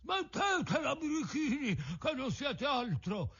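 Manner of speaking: very high, slow crab voice; big crab crying out, high and drawn-out; trombone with a cold. A narrator's reading. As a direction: scold slowly in a strained, high-pitched creature voice. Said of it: "gridò con una vociaccia di trombone infreddato".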